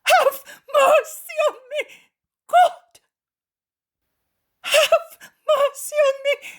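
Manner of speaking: crying out very high and loud, sobbing; despairing wail; anguished shouting. A narrator's reading. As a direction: beg for mercy through sobs. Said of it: "covering his face and shouting".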